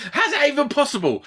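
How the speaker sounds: high-pitched baffled partner voice; high, exasperated impression of partner; imitating incredulous partner. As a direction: squeal the question in mock disbelief. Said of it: "She's like".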